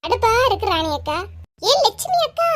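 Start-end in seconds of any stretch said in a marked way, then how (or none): 1.7-2.5 high, ringing call to a neighbour's house. Acted. Angry neighbour Geetha calls Lakshmi out to quarrel, high calling voice. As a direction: shout her name across the yard.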